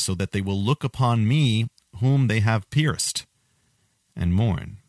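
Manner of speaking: slow, weighty scripture reading, every word laid down; solemn slow reading. Shown as a demonstration. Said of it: "Then it says".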